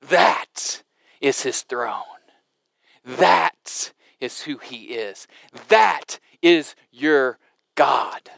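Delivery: low, slow and reverent; hushed awe. Real, not acted. Preacher slowly naming God's throne to the congregation, hushed. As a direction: let each sentence settle slowly in wonder.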